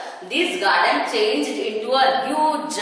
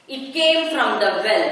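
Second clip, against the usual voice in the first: plain reading voice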